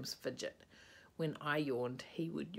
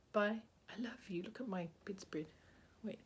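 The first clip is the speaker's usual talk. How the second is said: ordinary delivery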